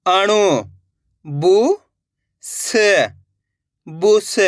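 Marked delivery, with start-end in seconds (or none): 1.2-2.7 each syllable sounded separately, pauses between; letter by letter. Shown as a demonstration. Teacher sounds out a word for beginners, letter by letter. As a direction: break the word into its letters, slowly. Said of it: "एक ही अक्षर का उच्चारण".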